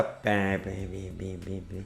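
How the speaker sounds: train chugs fading away softly; locomotive imitation. Shown as a demonstration. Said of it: "e na frente da locomotiva".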